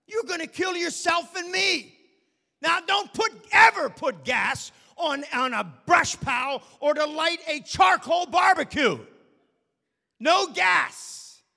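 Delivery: high, loud shouted warning; shouting alarm at a gasoline fire; furious shout. Retold or imitated. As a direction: yell at the fool with the match. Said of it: "I'd say".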